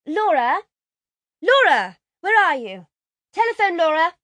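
high, loud call from the house; calling out loudly, high and insistent; crying out. A narrator's reading. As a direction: summon someone from another room. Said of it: "a voice cried from the house".

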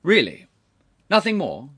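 loud, high, acid sarcasm. A narrator's reading. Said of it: "Sir Charles had turned acid again".

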